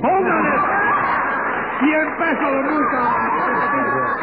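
high, drawn-out courtroom call; judge's outcry. Acted. Judge to court secretary imposing a fine, high drawn-out call. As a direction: call out the sentence, high and stretched.

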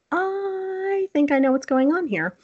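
slow, suspenseful quoted thought; drawn-out dawning realization. Retold or imitated. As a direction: stretch the opening word high as it dawns on you. Her own thought, quoted in story.

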